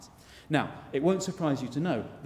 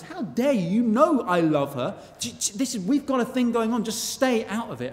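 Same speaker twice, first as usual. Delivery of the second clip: high, louder outraged retort; offended knight; 10 semitones higher, 5 dB louder than usual. Retold or imitated. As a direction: hit back, pitched up and huffy. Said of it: "Ronaldo says".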